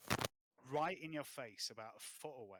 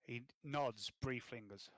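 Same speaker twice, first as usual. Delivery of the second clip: ordinary delivery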